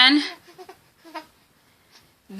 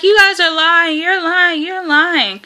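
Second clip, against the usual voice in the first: mock accusing; 8 semitones higher than usual. Accusing staff in labor.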